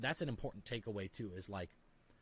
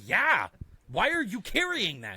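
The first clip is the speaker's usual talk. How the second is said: louder, higher voice of a blunt friend; friend's loud challenge; 9 semitones higher, 12 dB louder, slower than usual. Retold or imitated. Friend asking why he carries it, loud and blunt. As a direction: push back, amused and loud.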